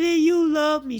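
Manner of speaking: high, loud retold lament; remorseful wail. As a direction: imitate a mother wailing in remorse. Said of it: "my mama was so sorry".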